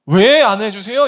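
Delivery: high, loud indignant outburst; heated protest. Acted. Preacher voicing Cain protesting to God, heated outburst. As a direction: burst out as if denied your due. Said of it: "그 사실에 열받은 거예요".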